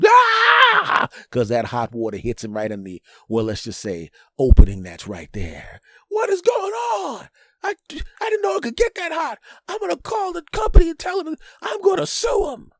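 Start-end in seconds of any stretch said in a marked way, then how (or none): 0.1-1.1 very high, loud mock scream; pained scream. Homeowner scalded by the bidet, high mock scream. Hot water hits him. 6.1-12.7 outraged shout. Acted. Scalded homeowner threatening to sue, high outraged shout.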